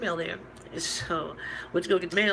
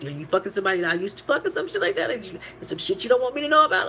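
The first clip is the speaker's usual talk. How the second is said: quick, rising, louder accusing rant; fast, heated, higher and higher; fast accusation; 4 semitones higher, 5 dB louder, faster than usual. Retold or imitated. Accusing friend in retold quarrel.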